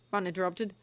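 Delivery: plain reading voice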